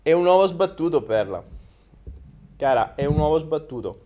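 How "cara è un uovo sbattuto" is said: normal talking voice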